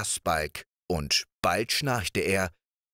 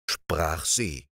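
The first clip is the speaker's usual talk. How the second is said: ordinary delivery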